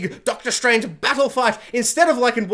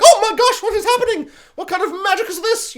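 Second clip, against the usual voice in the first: high, loud, laughing panic over magic; startled Hogwarts student; 13 semitones higher, 5 dB louder than usual. Retold or imitated. Hogwarts student yelping at a portal smashing through, high and giggly. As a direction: squeal it out like a student caught mid-spell chaos.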